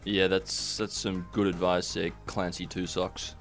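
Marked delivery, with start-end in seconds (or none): none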